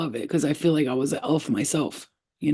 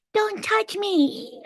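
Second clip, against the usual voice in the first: very high, drawn-out squeal; elf voice; much slower than usual. Retold or imitated. Little elf warding off a giant alien, high squeaky voice. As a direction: squeal it like a tiny indignant elf. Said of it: "I was talking like an elf".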